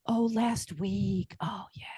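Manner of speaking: slow whisper. Retold or imitated. Taurus moon slow reaction voiced.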